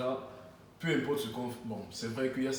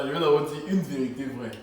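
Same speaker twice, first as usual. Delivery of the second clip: ordinary delivery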